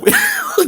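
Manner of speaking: a sudden high-pitched laugh; high laughing burst; high burst of laughter. Real, not acted. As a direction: crack up in disbelief. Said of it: "God, I'm, oh Lord".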